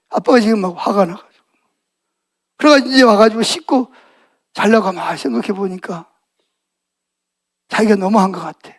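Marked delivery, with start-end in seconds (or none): none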